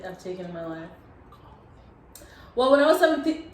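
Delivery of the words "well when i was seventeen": put-on Spanish accent rolling seventeen. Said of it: "That's my Spanish accent".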